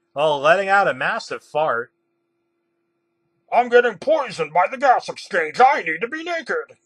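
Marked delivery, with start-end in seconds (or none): 3.5-6.8 loud, high-pitched hammed-up panic; frantic cry. A narrator's reading. Green panics at the gas in the room, crying out. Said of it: "Green cried".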